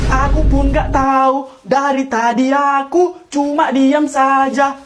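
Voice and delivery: slow, drawn-out excuse; exaggeratedly measured speech; affected slow talk. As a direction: stretch each phrase evenly. Continues mocked drawn-out delivery.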